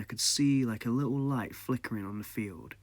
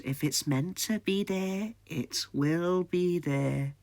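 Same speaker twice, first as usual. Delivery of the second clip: put-on high, very slow, knowing old lady; drawn-out cryptic old woman's words; mysterious old woman; 8 semitones higher, slower than usual. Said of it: "She goes".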